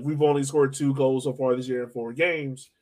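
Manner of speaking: ordinary talk, nothing marked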